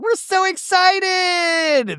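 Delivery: very high, slow, gushing squeal; mock squealing excitement. Brittany squealing to the group before the flight. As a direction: squeal it like a giddy reality star.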